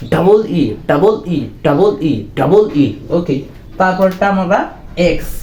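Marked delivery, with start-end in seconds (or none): 0.1-2.9 "ডাবল ই" repeated as double plus a separate vowel; modern W pronunciation. Shown as a demonstration. Teacher drills what students must learn, split-word pronunciation. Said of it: "কি শিখতে হবে?".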